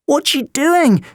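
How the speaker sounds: high, alarmed gasp; startled by the apparition. A narrator's reading. Vida gasps at the ghost, frightened and high. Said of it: "gasped Vida".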